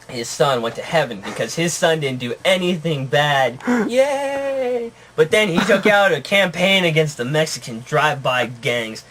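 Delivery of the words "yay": high, drawn-out yay; mock cheer. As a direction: give a playful, high-pitched cheer. Cheer for son reaching heaven.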